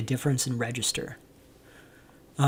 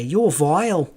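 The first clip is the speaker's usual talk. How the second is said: a light Northern English "You're vile"; muted Northern English mimic; 2 semitones higher, slower than usual. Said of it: "especially when they say".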